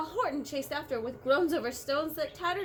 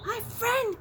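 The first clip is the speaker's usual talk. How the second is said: much higher little voice; mayor of the speck, grateful; tiny Who voice; 11 semitones higher, 5 dB louder than usual. A narrator's reading. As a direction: speak as a tiny grateful person. Voice on the dust speck.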